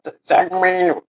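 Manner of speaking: high, slowed, loud cartoonish questioning; eager character voice. Character asking if they will win viewers, high put-on voice.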